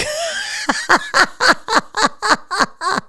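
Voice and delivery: loud high burst of laughter; loud delighted laughter; big laugh. Real, not acted. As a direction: crack up out loud. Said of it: "I'm laughing now".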